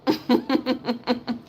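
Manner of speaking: long chuckle in a rapid string; real mocking laugh. Real, not acted. Woman mocking Cuban voters' regret, a long gloating chuckle.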